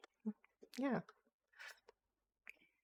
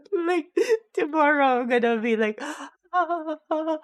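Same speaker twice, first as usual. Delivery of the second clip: high, loud, crying with laughter; laughing fit; 10 semitones higher, 6 dB louder than usual. Real, not acted. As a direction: laugh yourself breathless over the joke. Laughing at own craziness.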